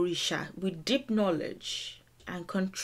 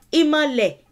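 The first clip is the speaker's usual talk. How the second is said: "Imale" called out higher and louder, falling; "Imale" said high and loud as the key word; stressed example word; 7 semitones higher, 8 dB louder than usual. Shown as a demonstration. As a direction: punch "Imale" to set it apart. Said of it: "is just one".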